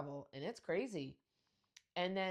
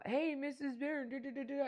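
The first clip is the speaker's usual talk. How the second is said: mock teen friend; 9 semitones higher than usual. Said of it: "their friends are like".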